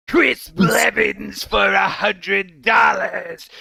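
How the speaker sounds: slow shouted demon wail; Exorcist demon voice. Acted. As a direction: wail it slowly, possessed. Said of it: "in the Ashton voice".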